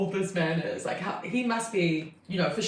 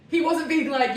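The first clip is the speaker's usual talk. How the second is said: ordinary talk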